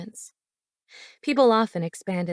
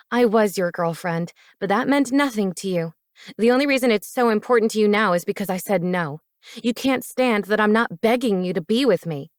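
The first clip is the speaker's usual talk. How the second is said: louder bitter accusation, speeding up; angry; 4 dB louder than usual. Acted. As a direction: throw his words back at him.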